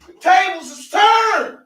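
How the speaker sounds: slow, high triumphant shout; drawn-out gloating proclamation. Real, not acted. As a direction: crow it out, the tables have turned. Gloating announcement.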